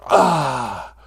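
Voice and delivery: loud drawn-out "ah" of effort; big comic straining cry; straining goose groan. Acted. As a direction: groan loudly as if pushing out an egg. Goose straining to lay egg.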